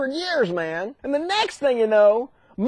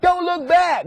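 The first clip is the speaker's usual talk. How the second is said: higher-pitched warning call; mock urgent warning; 6 semitones higher, slower than usual. Angels warn the fleeing family.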